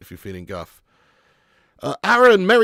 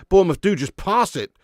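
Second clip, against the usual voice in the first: usual speaking voice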